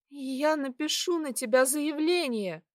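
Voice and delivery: high, frightened threat; trembling voice. A narrator's reading. Girl threatening to report her attacker, shaking. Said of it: "Мой голос сильно дрожал".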